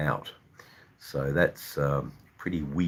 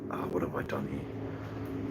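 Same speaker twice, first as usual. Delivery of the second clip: whispered to self; 8 dB quieter than usual. Real, not acted. Muttering to himself while fiddling.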